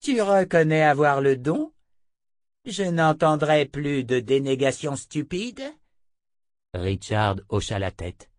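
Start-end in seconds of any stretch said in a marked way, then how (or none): 0.0-5.6 higher-pitched, commanding woman; scornful witch questioning; imperious seer. Acted. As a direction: press him in a high, stern voice. Narrator voices Chota the seer.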